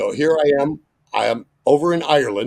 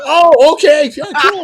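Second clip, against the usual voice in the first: high, loud flustered cover; awkward surprised okay; 11 dB louder than usual. Retold or imitated. Host reacts to [name]'s dating news, flustered. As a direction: squeak out a fake-cool reply.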